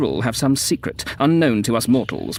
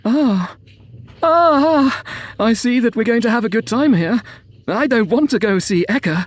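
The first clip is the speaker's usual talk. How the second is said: high, louder gushing voice; squealing excitable woman; 11 semitones higher, 5 dB louder than usual. A narrator's reading. Woman expecting a good time, high excited gushing.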